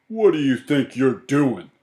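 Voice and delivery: loud, indignant demand; indignant man. A narrator's reading. Man confronting Mercy over the popcorn, loud and indignant. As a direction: confront the culprit loudly, as an outraged man.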